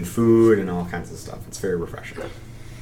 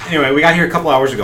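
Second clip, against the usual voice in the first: fast; 6 semitones higher, 6 dB louder, much faster than usual. Acted. Kandara's radio message continues.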